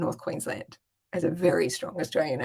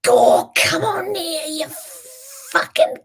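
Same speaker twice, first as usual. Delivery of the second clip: dad bellowing at cattle, pitched way up; imitated yelling; 12 semitones higher, 4 dB louder than usual. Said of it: "yelling at the top of his lungs".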